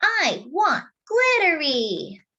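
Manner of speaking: put-on high, slow pleading toddler; wanting little boy. Toddler brother asks for the skirt, slow and high. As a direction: stretch each word out, wanting it. Casey wants it.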